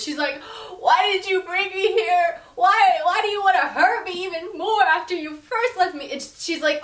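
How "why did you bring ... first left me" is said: high, loud tearful accusation; hammed-up weepy bride pleading; in tears. Jasmine confronts Derek at the church, in a high tearful plea. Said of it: "And Jasmine in tears".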